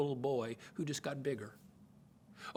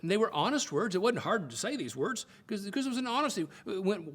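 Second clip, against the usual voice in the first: normal talking voice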